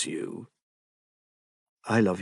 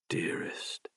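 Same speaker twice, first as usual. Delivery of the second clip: whispered endearment; 13 dB quieter than usual. Acted.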